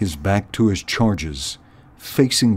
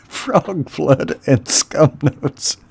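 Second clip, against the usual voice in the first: slow, chuckling repeat; tickled, drawn-out aside; amused relish; 6 semitones higher, slower than usual. Real, not acted. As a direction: savour the silly names after laughing. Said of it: "I love these names".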